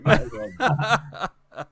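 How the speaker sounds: slow, laughing through the words. Real, not acted. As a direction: chuckle the line out. Joking about slow internet.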